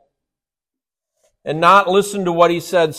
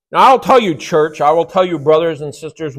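normal talking voice

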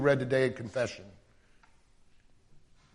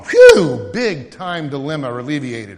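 normal talking voice

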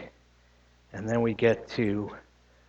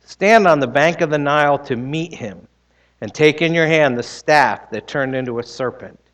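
louder, proclaiming scripture voice; scripture reading; 3 semitones higher, 5 dB louder than usual. Acted. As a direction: read the Lord's words with weight. Reads Exodus aloud in sermon.

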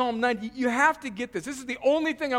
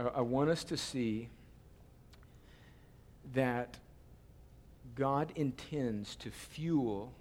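much lower, softer, slow and gentle; quiet reflective preaching; 9 semitones lower, 8 dB quieter than usual. Acted. Pastor gently closing with Titus to the congregation, tender. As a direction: let it settle, slow and tender.

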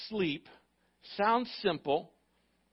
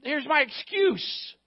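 high, much louder "Oh, oh, wait" of someone dodging; mock excuse; 6 semitones higher, 7 dB louder than usual. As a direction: imitate the believer making excuses, higher. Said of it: "You know".

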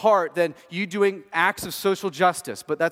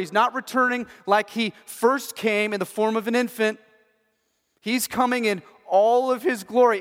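fervent preaching; 4 semitones higher, 3 dB louder than usual. Real, not acted. Preaching builds in intensity.

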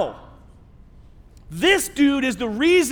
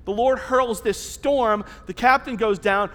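normal talking voice

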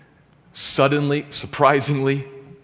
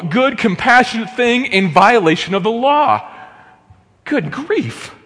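exasperated protest; 10 semitones higher, 4 dB louder than usual. Pretend. Pretend reader protests to Jesus.

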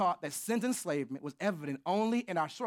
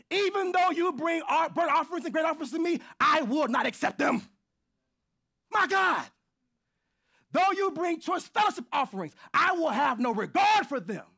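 high, loud thundering shout as God's voice; booming prophetic shout; preaching at full force; 10 semitones higher, 8 dB louder than usual. Acted. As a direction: roar God's anger at the people. Preacher voicing God in Amos.

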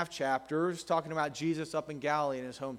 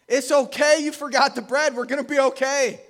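higher, louder hearty reassurance; calm them down with big cheer; reassuring Jesus; 9 semitones higher, 8 dB louder than usual. Retold or imitated. Jesus to disciples who forgot bread, hearty reassurance. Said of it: "Jesus is saying".

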